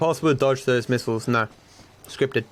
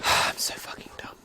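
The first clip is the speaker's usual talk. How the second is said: sighing whisper at himself; whispered self-scolding; 7 dB quieter than usual. Real, not acted. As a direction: breathe it out under the breath. Muttered self-scolding after mistake.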